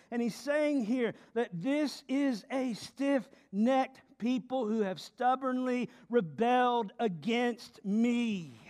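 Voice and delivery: ordinary delivery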